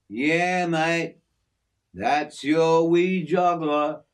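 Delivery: easygoing outback mate showing off his aviary; hint of a broad Australian working man; Aussie bloke Jim. Jim answers the boy.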